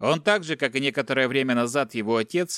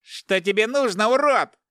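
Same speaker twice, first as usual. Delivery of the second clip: high, much louder shriek; 10 semitones higher, 5 dB louder than usual. Acted. Son shrieking at the captor, high and loud. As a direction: shriek it at him. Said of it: "заверещал Игорь".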